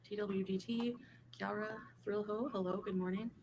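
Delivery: faint, mic not on yet; quiet, far from the mic; barely audible. Real, not acted. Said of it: "I can barely hear her".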